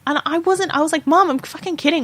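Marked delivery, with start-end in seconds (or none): 1.1-2.0 exasperated. Retold or imitated. Retelling exasperated confession of joke.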